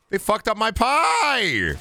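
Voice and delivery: high, drawn-out complaint; outraged cry. Real, not acted. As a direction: wail about it, slow and high. Complains game ruined pie gag.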